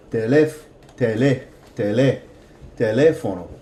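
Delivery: teléfono with the second E leaned on harder; stress on second E. Shown as a demonstration. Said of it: "put more emphasis on that vowel than the preceding vowel".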